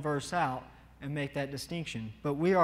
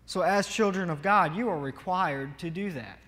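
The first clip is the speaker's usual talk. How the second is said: ordinary talk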